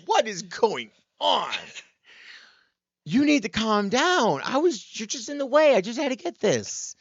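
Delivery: acted-out baffled self-defence; mock exasperation. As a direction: argue back, hammed up. Acting out exasperated side of quarrel.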